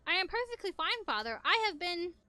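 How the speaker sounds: high, stiff, dutiful reply; stiff and formal. Acted. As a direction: answer like a stiff servant. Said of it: "talking to Father in the same stiff manner".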